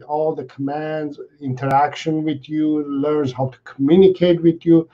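ordinary talk

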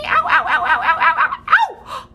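high laughing cries of "ow"; playful mock ouch. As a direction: squeal ow while laughing. Pretend dinosaur bite.

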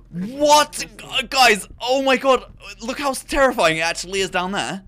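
startled gasp, then loud panicked cries; jolted and shouting in fright; jumpy. Real, not acted. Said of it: "I'm really jumpy right now".

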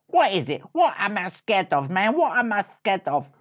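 high, insistent demand; squeaky little mouse demanding an answer; indignant challenge. Acted. Little mouse challenging his brother's claim, indignant.

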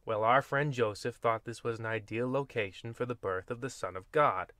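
ordinary delivery

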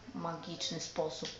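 usual speaking voice

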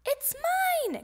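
high, stretched-out claim; high-pitched excited shout; excited cry. A narrator's reading. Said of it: "cried Froggy".

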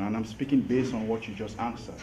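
ordinary delivery

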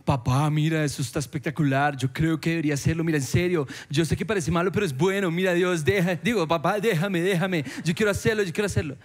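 lightly voiced child pleading with dad, eager; child begging dad. Child begging his father to let him do it, mild pleading. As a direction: wheedle and insist like a kid who wants it. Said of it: "como cuando uno le dice al papá".

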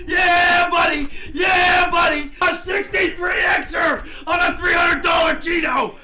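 high, stretched-out victory yell; real jackpot elation; triumphant shouting. Real, not acted. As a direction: holler it slowly, savouring the win. Celebrating a huge gambling win.

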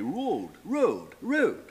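road, road, road, vowel rising; climbing road. Shown as a demonstration. Showing the vowel climbing: road, rising vowel. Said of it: "climbed up in the same way".

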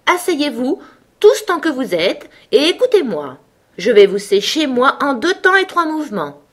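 high, firm order to the whole group; strong commanding voice. A narrator's reading. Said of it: "ordonna d'une voix forte".

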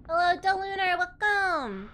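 high, bubbly welcoming voice; squeaky-sweet anime girl host; cute anime streamer. Acted. Greeting in persona.

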